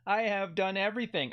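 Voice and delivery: normal talking voice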